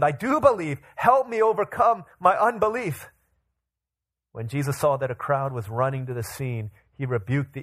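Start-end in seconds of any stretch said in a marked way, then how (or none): 0.0-3.1 higher-pitched earnest cry for help; exclaiming. A narrator's reading. The boy's father begs Jesus for help, exclaiming. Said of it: "the boy's father exclaimed".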